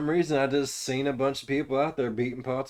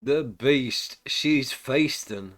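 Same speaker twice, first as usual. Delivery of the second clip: slow, loud headline read; news headline voice; 4 dB louder, slower than usual. Acted. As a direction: announce it like a tabloid headline. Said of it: "This is not your usual daily news".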